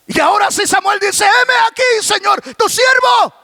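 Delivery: high, projected declaration; fervent preaching climax. Acted. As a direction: build to a ringing answer to God. Preacher climaxes Samuel's answer to God. (declaración ferviente en tono alto)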